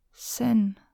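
hushed, absent-minded utterance; murmured. A narrator's reading. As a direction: murmur the name under your breath. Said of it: "murmelte sie".

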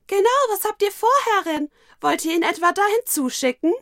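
viel höher, schnell und laut; hohe schnelle Kinderstimme. Acted. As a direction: eifrig kindlich nachhaken. Dienerin Moru stimmt ein. (hohe schnelle Kinderstimme)

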